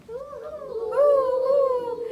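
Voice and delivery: loud, high hooting call; owl hoot. Acted. Reading to child, the outraged owl hoots loudly. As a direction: holler it like an angry owl. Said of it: "who hollered".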